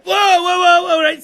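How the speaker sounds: loud, high whoa, whoa; mock alarm. Comedian reacting loudly to his own swear word.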